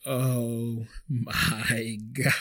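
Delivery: very slow, drawn-out dawning horror; mock dismay. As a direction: stretch each word in acted dismay. Acts out horrified realisation.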